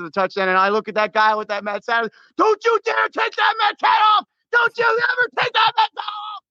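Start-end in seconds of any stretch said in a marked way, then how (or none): 2.4-6.4 very high, screaming stadium yell. Retold or imitated. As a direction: scream it at the kid in the Mets hat. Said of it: "the last thing I did screaming".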